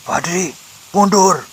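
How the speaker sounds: high, drawn-out urgent call; elder calling out to back away; warning shout. A narrator's reading. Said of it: "teriak Mbak Uti".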